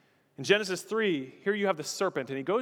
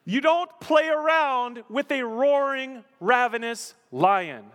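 loud, slow, high-pitched warning; forceful warning; 8 semitones higher, 7 dB louder, slower than usual. Real, not acted. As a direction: hammer each word out slowly. Preacher driving home the warning.

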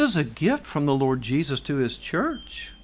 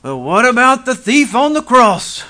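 loud, challenging objector's question, pitched up; mock rebuttal; 8 semitones higher, 10 dB louder than usual. Preacher voicing a typical objector's rebuttal, loud and challenging. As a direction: throw the objection back at them, loud. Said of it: "everybody always has this rebuttal".

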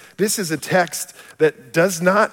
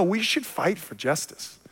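ordinary delivery

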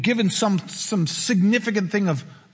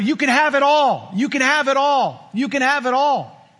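higher, louder gloating villain; the devil offering the kingdoms like a film villain, higher and gloating; villain emperor; 7 semitones higher, 4 dB louder than usual. Acted. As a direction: savor it like the Emperor offering the world. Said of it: "This sounds like the Emperor".

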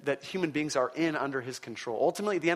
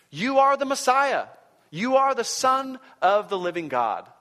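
loud, much higher proclamation; emphatic declaration; 8 semitones higher, 6 dB louder than usual. Acted. Peter declares to Jesus, loud and emphatic. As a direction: declare it with conviction. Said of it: "Peter responds accurately".

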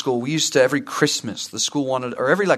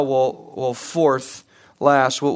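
ordinary talk

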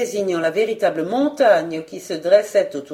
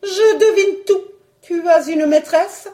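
high, loud accusing cry; indignant exclamation; sudden outcry; 9 semitones higher, 6 dB louder than usual. Acted. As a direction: burst out with high-pitched suspicion. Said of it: "elle s'écria".

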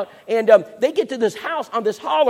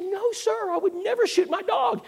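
nervous protest; 13 semitones higher than usual. Retold or imitated. Nervous reply to neighbour.

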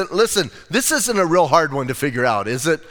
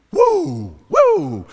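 high-pitched whooped "woo"; 13 semitones higher, 3 dB louder than usual. Real, not acted. Preacher reacting to Jesus' bold words, high whoop.

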